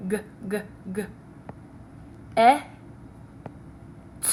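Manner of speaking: "G, g, g" as short repeated guh, then short eh for "E", a bare "t"; sounded out. Shown as a demonstration. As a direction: sound out "G, g, g. E, t." with long gaps. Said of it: "give you the sound in the word get".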